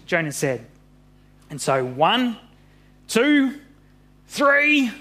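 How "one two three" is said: high, building count to a heave; countdown call. Acted. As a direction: count it up high before the throw. Counting down to the throw.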